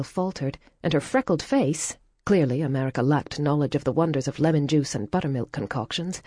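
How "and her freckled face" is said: ordinary delivery, nothing marked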